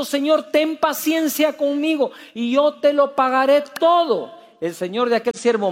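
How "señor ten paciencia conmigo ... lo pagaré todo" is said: higher, begging plea to his master; a servant begging his lord for patience, pleading. A narrator's reading. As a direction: beg the lord for more time, voice lifting. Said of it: "le suplicaba diciendo".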